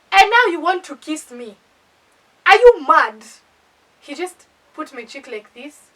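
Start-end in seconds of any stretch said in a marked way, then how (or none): 0.1-3.3 loud, high, outraged retelling; pissed off. Retold or imitated. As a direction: act out an indignant outburst at a date. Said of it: "I'm already pissed".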